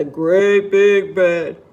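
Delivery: deep, weighty storyteller voice; big low voice for something enormous; great big voice. A narrator's reading. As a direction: drop low to sound huge. Voices the big bed's size.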